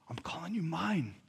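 hushed, intimate claim; tender whisper. Acted. God to a forgiven sinner, tender whisper. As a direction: drop to a soft whisper. God's tender words after the list.

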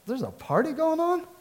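put-on surprised older son. Older son hearing music from the house, surprised. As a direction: sound shocked there's a party.

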